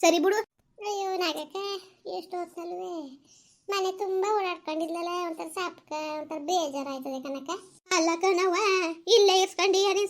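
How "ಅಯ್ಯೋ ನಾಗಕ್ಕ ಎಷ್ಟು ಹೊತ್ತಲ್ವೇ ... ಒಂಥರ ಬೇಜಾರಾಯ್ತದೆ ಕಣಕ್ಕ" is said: soft, higher sighing voice; missing her, speak soft and downcast; sad and lonely. Acted. Mother-in-law telling neighbour she misses her daughter-in-law, soft and sad.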